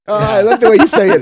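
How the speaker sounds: put-on high, loud gushing girl voice; swooning girl. California girl swooning over his accent, high gushing voice. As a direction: gush like a smitten teenager.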